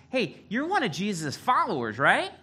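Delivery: high, loud mock girl voice; little servant girl. Servant girl confronting Peter by the fire, in a high mock girl voice. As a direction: pipe up as an accusing little girl. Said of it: "A little servant girl comes up".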